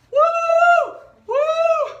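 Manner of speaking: long, high, loud whoop; excited whoop. Real, not acted. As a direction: let out a piercing cheer. Whooping while hula hooping.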